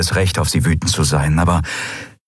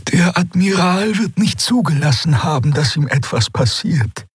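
much higher, light reassuring Xea voice; reassuring alien voice; 10 semitones higher than usual. A narrator's reading. Xea reassuring Endriel about the admiral, high and calm. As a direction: comfort her in a high, gentle voice. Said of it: "sagte sie".

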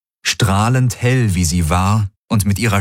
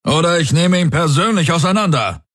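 barked order; 9 semitones higher than usual. A narrator's reading. Commander barking into his comm, a sharp order. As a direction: bark it like a warlord commanding his men. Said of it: "bellte Daraios".

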